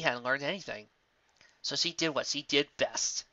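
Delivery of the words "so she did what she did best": ordinary delivery, nothing marked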